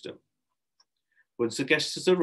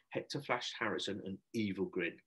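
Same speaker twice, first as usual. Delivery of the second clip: plain reading voice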